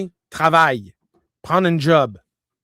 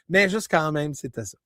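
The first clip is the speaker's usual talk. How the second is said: ordinary talk, nothing marked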